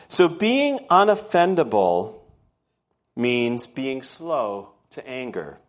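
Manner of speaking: slow, weighty definition; stating the point of the sermon; slow emphasis. Real, not acted. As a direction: lay out each word slowly. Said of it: "slow emphatic key definition".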